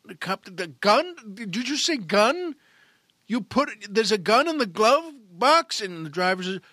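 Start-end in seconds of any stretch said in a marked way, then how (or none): 0.4-5.8 higher, louder, stunned questioning; a cop hearing about a gun, startled; alarmed cop. Acted.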